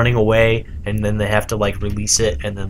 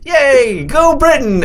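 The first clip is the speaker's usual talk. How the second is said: cheering; 5 dB louder, much slower than usual. Retold or imitated. Mocking cheering Brits in retelling.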